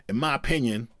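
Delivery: a quick, half-hearted imitation of Kanye on stage; [name] impression. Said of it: "I can't do the [name] an-, uh, accent".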